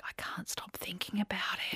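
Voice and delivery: soft, barely voiced confession; shaken whisper. Partner admits on the phone he is still shaken, whispered. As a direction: whisper it like it still haunts you.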